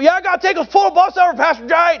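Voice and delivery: very high Southern teen impression; Dan Parchman. Teen begging pastor to stop the bus, high imitated voice. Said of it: "That's how he talked".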